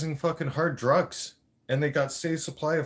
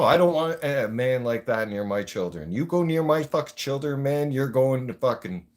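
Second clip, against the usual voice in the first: ordinary talk, nothing marked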